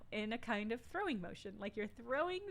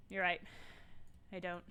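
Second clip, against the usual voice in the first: usual speaking voice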